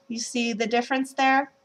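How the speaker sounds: ordinary delivery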